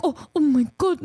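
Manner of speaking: light imitation of mom's Filipino accent; imitating mom's Filipino accent. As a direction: mimic mom gasping in a Filipino accent. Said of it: "her Filipino accent".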